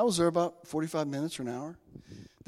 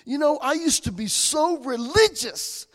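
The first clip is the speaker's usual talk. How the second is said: rising, louder, fired-up confession; getting excited; 7 semitones higher, 5 dB louder than usual. Real, not acted. Telling the stranger about his old religiousness, getting excited and louder. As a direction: let the excitement run away with you. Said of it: "I'm getting excited".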